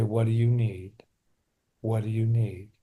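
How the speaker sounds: put-on soft, gentle question to the inner child; tender self-talk. Shown as a demonstration. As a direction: ask it softly, like comforting a hurt kid. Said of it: "with my hands on my heart".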